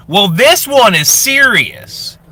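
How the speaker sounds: oversharing Facebook lady. Acted. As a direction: coo it out like a proud status update. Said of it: "She plays two characters".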